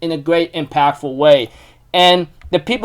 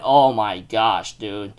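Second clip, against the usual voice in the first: much lower, softer and slow, in disbelief; stunned inner thought; 9 semitones lower, 8 dB quieter, much slower than usual. Retold or imitated. Thinking to himself after the episode, low and stunned. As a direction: let it sink under the breath. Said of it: "I'm thinking in my mind".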